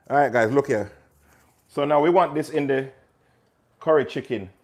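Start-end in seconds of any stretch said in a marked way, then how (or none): none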